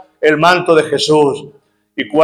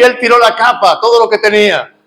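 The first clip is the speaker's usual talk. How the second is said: ordinary delivery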